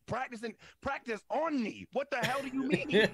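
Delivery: high, loud incredulous protest; outraged retort. Retold or imitated. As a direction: act out exploding at an absurd excuse. Acted outraged answer to girlfriend.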